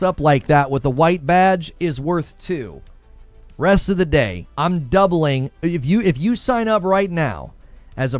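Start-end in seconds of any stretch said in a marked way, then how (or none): none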